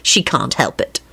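low, easy boast; deep, relaxed good cheer; cheerful. A narrator's reading. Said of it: "Lupin told Quentin cheerfully".

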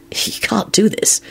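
slow, frightened whisper; afraid whisper. A narrator's reading. Lupin fears for Harry, whispering slowly. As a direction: barely breathe the words, too scared to speak up.

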